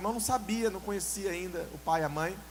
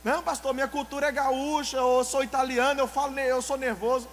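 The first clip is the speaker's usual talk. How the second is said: higher, louder mock protest; hammed-up defensive member; mimicking an excuse; 5 semitones higher, 6 dB louder than usual. Preacher mimics a member insisting he is just nervous by culture. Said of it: "ele fala alto".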